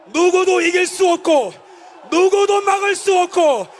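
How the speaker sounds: fiery political rally speech; roaring rallying speech. Acted. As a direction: shout it to the crowd.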